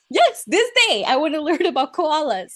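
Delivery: high, loud, giggling eager burst; bursting with eagerness to learn; excited aside. Real, not acted. Eager aside mid-story.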